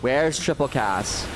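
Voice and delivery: ordinary talk, nothing marked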